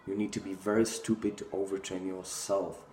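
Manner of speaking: usual speaking voice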